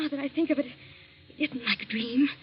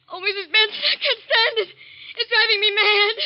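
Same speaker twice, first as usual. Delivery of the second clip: high, overwrought cry of despair; distraught; 6 semitones higher, 5 dB louder than usual. Acted. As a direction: at the end of your nerves, louder as it builds. Waking distraught from recurring nightmare.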